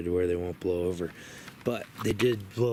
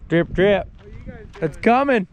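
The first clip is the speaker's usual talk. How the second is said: excited; 10 semitones higher, 9 dB louder than usual. Real, not acted. Windstorm hitting the tent.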